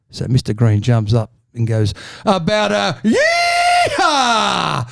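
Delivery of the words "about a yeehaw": cowboy yeehaw. Acted. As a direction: jump up and holler like a cowboy. Mister Green jumps up cowboy call.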